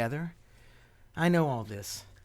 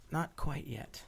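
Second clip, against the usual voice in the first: soft unvoiced whisper; 8 dB quieter, much slower than usual. Alec whispering to Laura before parting, breathy whisper. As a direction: breathe the words, no voice.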